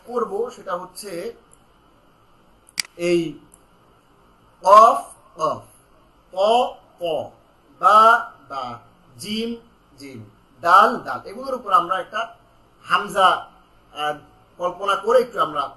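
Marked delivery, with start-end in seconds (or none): 4.6-11.0 "কফ, কফ" drawn out slowly with a louder bounced ending; slow tajweed letter demo; bounced qalqala letters. Shown as a demonstration. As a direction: hold each letter name, then bounce it. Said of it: "ধাক্কা দিয়ে stress দিয়ে পড়ব".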